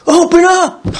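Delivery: 2 high yelled demand at the door; 1 mock shout. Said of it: "and I go".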